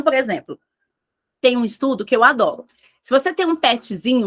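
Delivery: ordinary talk, nothing marked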